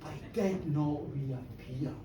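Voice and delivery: hushed dread. Acted. First take, hushed.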